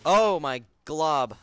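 slow, loud thrilled cry; excited. Real, not acted. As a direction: stretch out the excitement. Thrilled after a risky kill.

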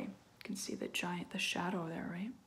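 hushed storyteller whisper. A narrator's reading. Narrator hushing the listener about the giant, whispered. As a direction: lean in and whisper it. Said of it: "whispered aside".

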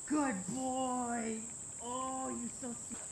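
gentle high praise for a puppy; soft dog praise. Real, not acted. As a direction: coo softly at the dog.

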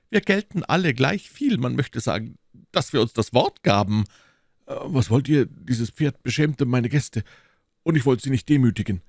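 high, animated character voice; lively, lifted musketeer dialogue; indignant musketeer. Acted. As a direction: justify yourself to your comrades.

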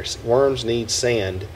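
ordinary talk

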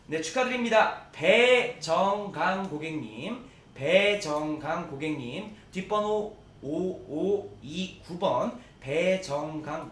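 loud, slow, stretched winner names; drawn-out winner call. Acted. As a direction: call the winner out slowly to everyone.

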